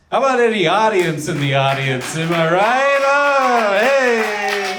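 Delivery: loud, high crowd hype; hype emcee. Acted. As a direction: pump up the room like a cheesy emcee. Hyping up the crowd.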